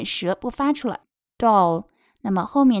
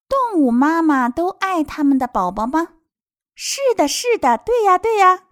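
high, animated question and eager answer; storybook translation reading; 8 semitones higher, 6 dB louder than usual. Acted. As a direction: reassure little listeners with keen yeses. Reads translation to children. (高昂、热情地给孩子朗读)

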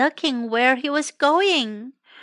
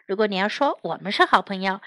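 plain reading voice

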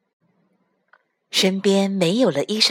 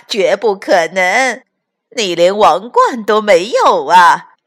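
mocking laughter; 8 dB louder than usual. Frogs mock with laughter.

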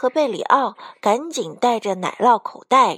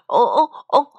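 muffled noises through a mouthful; grunted words round food; mouth full; 5 dB louder than usual. A narrator's reading. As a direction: pretend your mouth is stuffed. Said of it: "贝里奥嘴里塞得满满的".

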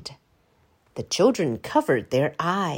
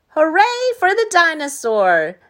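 high, louder cheer; cheering children; 9 semitones higher, 6 dB louder than usual. A narrator's reading. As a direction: cheer for the dinosaur. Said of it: "the children cried".

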